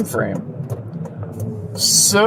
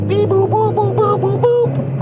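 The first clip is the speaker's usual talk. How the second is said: very high squeaky beep-boops; robot beeps. Pretend. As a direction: chirp like a little machine. Imitating a multitasking robot.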